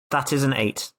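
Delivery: ordinary delivery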